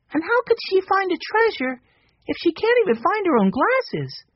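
put-on high, light little boy voice; young Benny. A narrator's reading. Narrator voicing young Benny.

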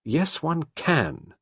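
slow, high, emphatic insistence; firm contradiction, pitched high; uncompromising. A narrator's reading. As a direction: insist firmly, stretching each word. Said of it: "said Sarah uncompromisingly".

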